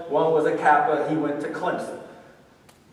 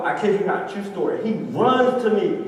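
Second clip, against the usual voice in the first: ordinary talk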